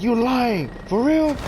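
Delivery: high-pitched incredulous question; acted disbelief. Friend leading the way hears a warning, high disbelief. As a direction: rise high as if you can't believe it.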